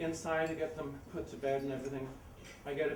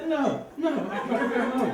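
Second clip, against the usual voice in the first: flustered refusal; 7 semitones higher, 8 dB louder than usual. Retold or imitated.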